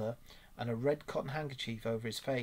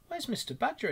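much higher, rising question; 12 semitones higher than usual. A narrator's reading. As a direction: pitch the mole's question way up. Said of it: "inquired the mole".

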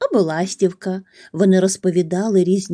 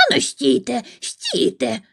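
higher-pitched mock shriek, held back in volume; 5 semitones higher than usual. A narrator's reading. Crow calls to his falling leaves, mild mock shriek. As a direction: call out in a high, restrained squeal. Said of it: "заверещав Чарлі".

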